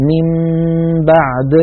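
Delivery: من بعد with nun turned to m and nasal hum held; iqlab with ghunna. Shown as a demonstration. Teacher demonstrates iqlab to students. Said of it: "فأقول".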